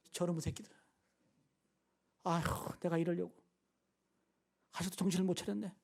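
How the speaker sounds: low, subdued imagined grumbling; muttered exasperation. Acted. Jesus imagined finding disciples asleep, muttered mock exasperation. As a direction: sigh and grumble under the breath.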